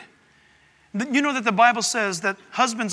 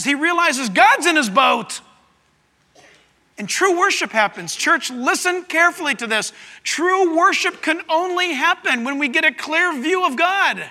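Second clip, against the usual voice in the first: loud, higher-pitched sermon delivery; impassioned preaching; 6 semitones higher, 5 dB louder than usual. Real, not acted. Preacher tells church of Peter falling before Jesus, impassioned preaching. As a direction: preach it at full force, driving each phrase.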